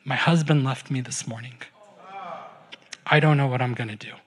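low, subdued imitation of a tearful woman; understated grief, pitch dropped; retold weeping. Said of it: "like, weeping".

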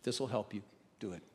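soft, low and gentle throughout; hushed invitation. Acted. Jesus gently inviting Thomas to touch, hushed. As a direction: invite him quietly, close up. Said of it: "He said".

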